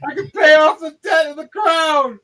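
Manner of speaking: high, loud whooping celebration; triumphant king. Acted. King celebrating his winnings, shouting high and loud. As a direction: cheer like your ship came in.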